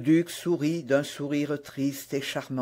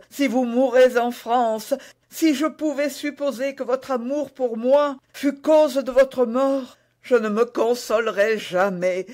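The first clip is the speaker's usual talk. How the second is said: high voice swelling louder with dread; anguished pleading; 5 semitones higher, 3 dB louder than usual. Acted. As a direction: build to a desperate, high-pitched entreaty. Queen fears his death, begs him. (supplication éperdue, voix haute et angoissée)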